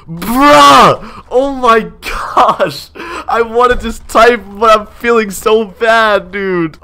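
wailing frustration, easing off at the end; outraged exclamation. Real, not acted. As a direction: cry out in high, stretched disbelief. Reacts to hearing he's boring.

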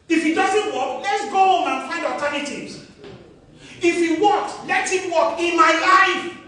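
loud, high-pitched pulpit preaching; preaching at full force. Real, not acted. Preacher exhorting the church about covid, loud and fervent. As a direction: rouse the congregation, rising to a peak.

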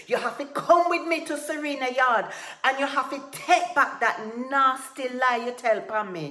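loud, high, insistent demand; angry shouted order. A narrator's reading. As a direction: bellow the demand in fury. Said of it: "he shouted".

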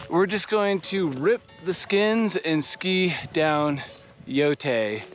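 ordinary talk

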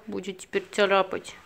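ordinary talk, nothing marked

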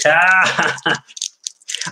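laugh. Real, not acted. Host joking about sleeves with chat, laughing. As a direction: burst out laughing.

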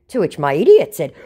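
ordinary talk, nothing marked

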